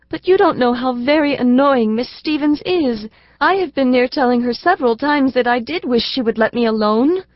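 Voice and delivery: a hint of a sweet little girl admiring her teacher; little girl Elsie. Elsie to her father, a lightly voiced earnest little girl. Elsie complains about governess.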